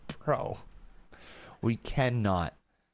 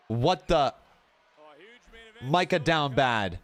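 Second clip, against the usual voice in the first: normal talking voice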